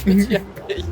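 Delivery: high-pitched playful mimicry with laughter; amused echo. Laughing echo of the local's phrase.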